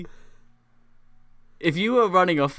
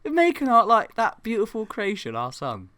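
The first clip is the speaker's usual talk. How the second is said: high, loud mock outrage; exasperated outburst; 9 semitones higher, 3 dB louder than usual. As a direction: yell in mock disbelief. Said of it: "I was like".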